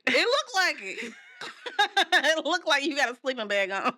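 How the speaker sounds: giggling through the words; laughing. Real, not acted. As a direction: laugh while teasing. Laughing while teasing about coat.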